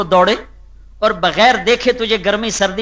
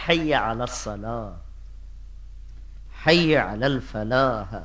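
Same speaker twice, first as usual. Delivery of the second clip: soft gentle call; 9 semitones lower, 12 dB quieter, slower than usual. Retold or imitated. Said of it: "لہجے".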